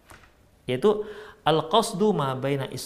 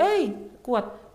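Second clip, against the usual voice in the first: high, mildly raised mock call; demonstrating calling out; 8 semitones higher than usual. Shown as a demonstration. Teacher showing how to call a friend who cannot hear, high calling voice. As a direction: call out to someone out of earshot. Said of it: "ya harus kuat suaranya dong".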